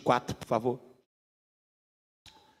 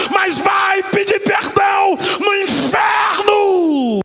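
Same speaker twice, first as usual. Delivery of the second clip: preaching at full force; 7 semitones higher than usual. Acted. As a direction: hammer each plea at the congregation. Preaches on pleas in hell.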